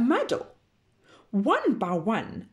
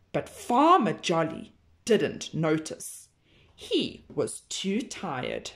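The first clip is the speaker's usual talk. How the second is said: usual speaking voice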